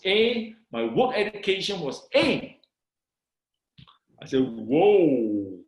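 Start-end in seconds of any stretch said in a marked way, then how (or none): 4.4-5.6 low, drawn-out whoa; retold awestruck exclamation; amazed. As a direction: let the wonder swell slowly. Amazed at seeing top results.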